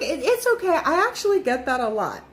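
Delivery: put-on sweet old lady. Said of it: "this lady was so sweet".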